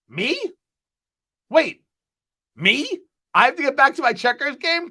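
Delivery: real affronted double take; indignant disbelief. Real, not acted. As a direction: bristle like you've been insulted. Indignant at a viewer comment.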